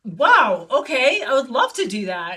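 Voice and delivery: retold thrilled acceptance. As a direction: accept with an impressed burst of energy.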